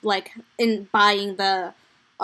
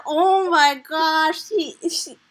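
giddy reaction; 6 semitones higher, slower than usual. Retold or imitated. Retelling her excited reaction.

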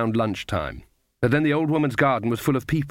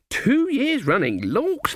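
even higher, gushing wonder; more mock impressed; 12 semitones higher, slower than usual. A narrator's reading. Granny gushes over his prize, much higher. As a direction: push the fake awe further up.

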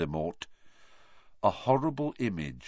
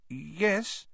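uncertain; 9 semitones higher than usual. A narrator's reading. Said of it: "said Neville uncertainly".

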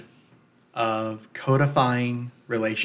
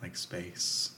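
flat, soft, emotionless monotone; empty, hollow, near-whispered; dead voice; 3 semitones lower, 15 dB quieter than usual. Shown as a demonstration. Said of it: "let your voice just, like, die. there's no emotion whatsoever".

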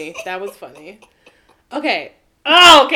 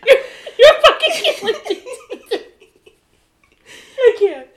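loud, high words through helpless laughter; laughing hard; 9 semitones higher, 7 dB louder than usual. Real, not acted. Co-host cracking up at her friend's mix-ups, laughing hard. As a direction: barely get it out for laughing.

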